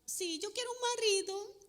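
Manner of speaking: put-on high pleading little-girl voice; high childish whine, lightly played. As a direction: imitate a tiny girl begging. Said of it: "una niñita chiquita que llora".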